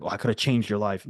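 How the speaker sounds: ordinary talk, nothing marked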